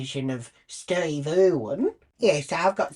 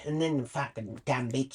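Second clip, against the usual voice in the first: ordinary talk, nothing marked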